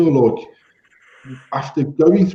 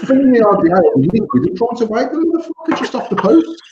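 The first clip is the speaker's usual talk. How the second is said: incredulous; 7 semitones higher than usual. Real, not acted. Disbelief at the customer's mistake.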